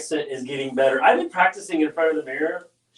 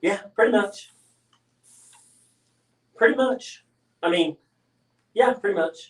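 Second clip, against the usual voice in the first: playful mock Texas accent, pitch up; lightly drawled Texas accent, higher; 4 semitones higher than usual. Showing off a Texas accent to the painter, playfully mock Texan. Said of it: "I have the Texas accent".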